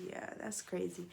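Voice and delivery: soft whispered aside; awed whisper. Retold or imitated. Telling the viewers her reaction, whispering in awe.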